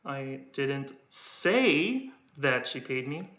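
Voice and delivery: "say" lifted and held, slow line; slow, deliberate, weight on "say"; stress on say. Shown as a demonstration. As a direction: lean on "say". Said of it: "I'm only stressing the one that I'm holding".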